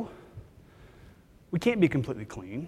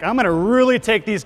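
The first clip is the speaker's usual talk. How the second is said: mock-menacing, louder and higher; mock threat; 6 semitones higher, 6 dB louder than usual. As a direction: fake a threat to the industry, playfully. No, I'm kidding.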